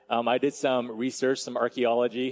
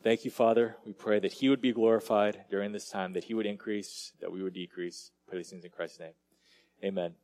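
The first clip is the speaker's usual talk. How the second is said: hushed, softening prayer voice; 6 semitones lower, 6 dB quieter than usual. Real, not acted. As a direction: close the prayer, fading lower. Closing prayer.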